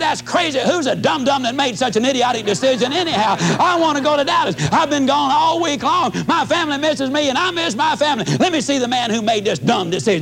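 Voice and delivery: hammed-up tantrum at the airline; mock rant. Traveler demanding to see the manager, hammed-up rant. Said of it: "ranted and raved and whooped".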